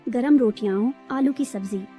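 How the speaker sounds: plain reading voice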